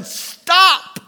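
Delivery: loud, high cry to make it stop; wailing plea. Retold or imitated. Convicted congregation begging the preacher to stop, wailing shout. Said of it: "they were weeping and they were wailing".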